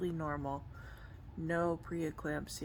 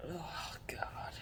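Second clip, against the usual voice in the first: soft whispered groan of pain; 10 dB quieter than usual. Real, not acted. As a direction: breathe it out, hurting. Pain under ribs, hospital.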